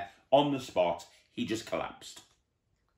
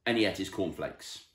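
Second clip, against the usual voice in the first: ordinary delivery, nothing marked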